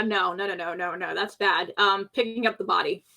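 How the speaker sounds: normal talking voice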